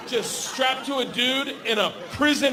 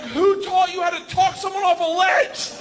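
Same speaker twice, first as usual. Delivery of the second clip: high, outraged shout over engine noise; re-enacted panicky, indignant holler; indignant yelling back; 10 semitones higher, 4 dB louder than usual. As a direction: yell it back in disbelief.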